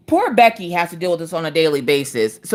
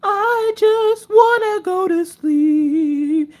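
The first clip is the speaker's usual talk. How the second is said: very high, drawn-out whine; slow, high self-mocking wail; exhausted whine; 13 semitones higher, much slower than usual. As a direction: drag it out like an overtired child. Acted whine after the rant.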